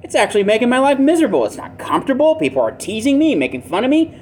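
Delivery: acted self-pitying protest; mocked man's complaint. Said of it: "he's gonna be mocked and jeered".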